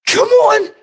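very high rallying "Come on"; excited cry. Real, not acted. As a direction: call out high to the crowd.

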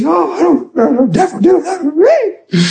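high, whiny imitation of complaint; mock whining. Preacher mimicking fearful believers to the devil, mock whining. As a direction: whine it in a squeaky voice, making fun. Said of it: "You say".

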